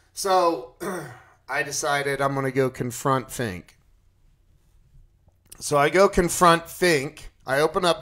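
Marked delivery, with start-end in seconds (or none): none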